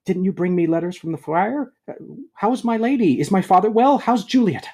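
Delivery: high, loud, eager rush of questions; thrilled young lover calling out; eager greeting. Acted. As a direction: burst out with joy at the news. Romeo greets servant eagerly.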